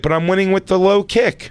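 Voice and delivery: high, mock innocent voice; imitating ex-wife. Ex-wife defending her button mashing, high mock innocent. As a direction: go up high like the clueless winner. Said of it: "She's like".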